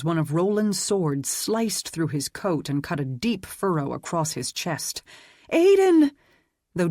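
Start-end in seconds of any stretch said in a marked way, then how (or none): 5.5-6.1 piercing scream, far higher and louder. A narrator's reading. Dana screaming as Aidan is cut, terrified scream. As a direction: shriek his name in terror.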